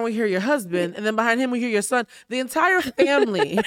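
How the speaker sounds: usual speaking voice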